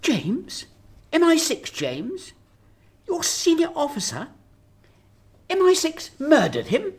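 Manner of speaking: horrified as it sinks in; shocked disbelief. Acted. PM shocked at murder news.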